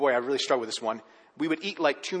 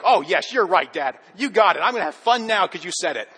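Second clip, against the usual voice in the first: louder mock-cheerful agreement; sarcastic compliance; 3 semitones higher, 7 dB louder than usual. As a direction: fake enthusiasm, rolling your eyes. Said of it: "Doesn't that just put the joy into you".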